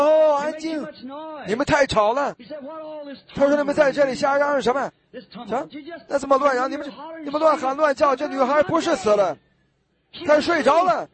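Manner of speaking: stern rebuke to the crowd. Acted. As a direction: cut the noisy crowd off. Jesus rebukes noisy mourners.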